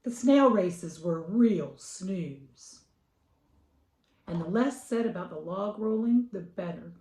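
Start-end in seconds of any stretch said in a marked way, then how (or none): 0.0-2.8 slow bored. A narrator's reading.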